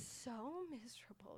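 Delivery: softly whispered misery. Real, not acted. Friend telling listeners how miserable it was, whispered. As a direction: breathe it out, wrecked. Recalling the worst moment.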